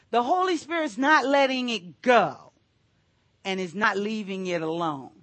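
ordinary talk, nothing marked

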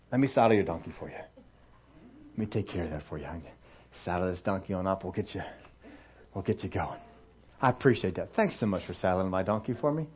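a soft, fawning host offering to saddle the donkey; hushed and eager to please, as if nothing happened; overly helpful prophet. Acted. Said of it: "the prophet says".

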